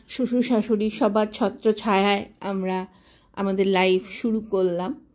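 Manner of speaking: ordinary delivery, nothing marked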